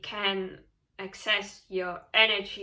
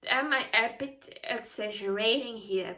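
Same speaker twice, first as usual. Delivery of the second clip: normal talking voice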